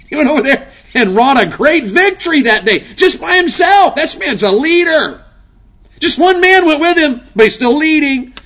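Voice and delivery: rising revival preacher's cry; preaching at full force. Acted. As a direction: thunder it out from the pulpit.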